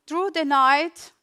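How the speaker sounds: normal talking voice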